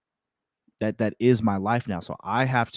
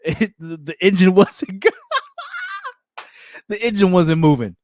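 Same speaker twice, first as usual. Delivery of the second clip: louder, higher, laughing through the words; 9 semitones higher, 5 dB louder than usual. Real, not acted. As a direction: laugh at the memory as you speak. Laughing at himself.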